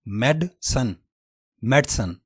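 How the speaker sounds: मेडसन in two syllables, mɛdsən; British pronunciation. Shown as a demonstration. Teacher demonstrates first British form of medicine. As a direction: drop the middle vowel in मेडसन. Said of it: "पहला तलफ़ुज़ है".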